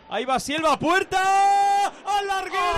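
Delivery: building excitement on the shot; rising commentary. Real, not acted. Free kick hits the bar.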